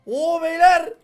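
high, very loud amazed call; amazed shout. Real, not acted. Player calling out to viewers in awe at an emerald block, loud. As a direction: call the guys over in awe.

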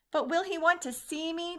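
called out high and pleading; cried out. A narrator's reading. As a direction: call after her, anxious to be heard. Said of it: "Punchinello cried out".

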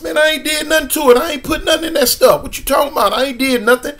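high, pleading denial; imitating his younger self denying it; protesting kid. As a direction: swear innocence to the angry man. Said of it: "I said".

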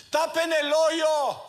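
put-on high, loud, slow yell of a running boy; kid's shout. Little boy running up to the gravediggers, shouting high and loud.

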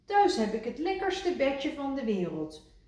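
high, wistful frog voice; Kikker longing. A narrator's reading. Kikker telling Rad, high wistful. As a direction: sigh for your bed.

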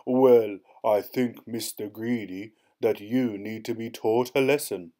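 giant bellowing. A narrator's reading. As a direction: lay down the law like a giant. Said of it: "he bellowed".

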